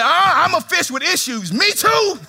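high, loud whiny fish confession; mock confession. Preacher voicing a fish with issues, mock whine. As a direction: wail it like a troubled fish.

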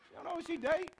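repeated through a laugh; amused repeat of the line, laughing. Real, not acted. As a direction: laugh at the punchline.

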